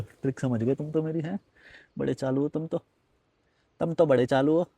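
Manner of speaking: low, very soft teasing; hushed, amused tease; teasing aside. Real, not acted. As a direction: drop right down for a sly aside to the viewers. Teasing viewers who got his trick.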